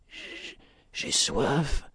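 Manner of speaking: very slow, faint, whispery; almost extinguished voice. A narrator's reading. Woman trapped under rubble, faint dying voice. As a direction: barely get the words out. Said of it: "une voix presque éteinte".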